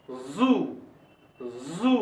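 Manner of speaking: जू low and soft with a held z; buzzed Z. Shown as a demonstration. Teacher models a Z word, buzzing "zoo" for जू. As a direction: say जू as "zzoo". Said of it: "तो उसमें वहां पर उच्चारण होगा".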